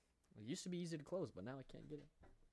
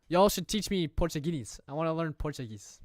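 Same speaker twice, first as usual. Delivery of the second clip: louder call asking Brazilian viewers for lessons; calling out to chat; 4 semitones higher, 14 dB louder than usual. Real, not acted. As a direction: shout it to the whole chat.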